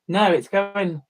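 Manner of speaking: ordinary talk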